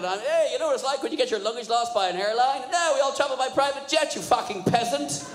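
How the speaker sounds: posh banker sneer. Retold or imitated. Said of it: "I said".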